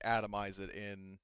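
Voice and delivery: usual speaking voice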